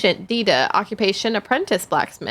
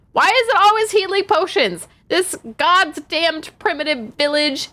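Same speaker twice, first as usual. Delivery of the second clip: high, loud and fed up; loud, high mock rant at the village; mock angry rant; 10 semitones higher, 5 dB louder than usual. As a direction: rant it like a bitter apprentice. Reading angry scrawled lines.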